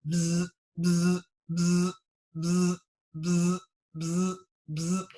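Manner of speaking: buzzing wasps. Pretend. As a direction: buzz it out like a jar full of wasps.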